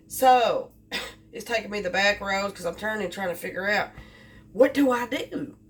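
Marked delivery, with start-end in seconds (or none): none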